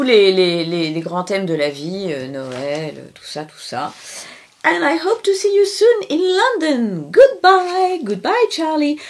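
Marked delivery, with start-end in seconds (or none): 4.6-7.1 put-on high little English boy signing off; child voice. Closing the story in Charlie's high child voice. 7.1-9.0 high sing-song farewell; child goodbye. Acted. Lightly voiced child saying goodbye at the book's end. Ending the story.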